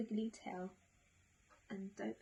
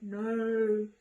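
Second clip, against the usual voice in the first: loud, drawn-out mock-frightened no; story owl's hammed-up alarm; 7 dB louder than usual. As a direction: cry out in pretend dismay. Owl reacts in fright after gasp.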